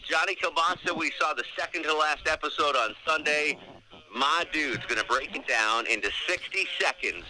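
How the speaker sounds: high, slow radio host intro; announcer voice. Acted. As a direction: hype up the segment. Hosting the segment intro.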